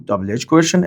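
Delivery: ordinary talk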